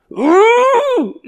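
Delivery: high, loud mock chest-beating howl; a hammed-up gorilla wail of grief; gorilla grief cry. Preacher acting out Hebrew grief, mock gorilla howl. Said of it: "beat the chest like a gorilla".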